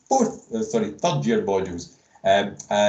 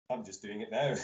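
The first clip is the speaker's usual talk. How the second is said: laughing; 5 semitones higher, 4 dB quieter than usual. Real, not acted. Jokes about his own copied slides.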